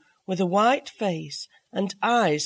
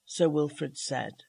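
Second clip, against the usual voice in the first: ordinary delivery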